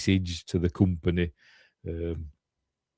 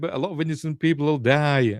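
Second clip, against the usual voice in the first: higher-pitched imitated protest; mock plea; 7 semitones higher than usual. Retold plea to save innocent lives, mock-urgent. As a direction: plead it in a raised voice. Voices Ripley's plea to Dylan.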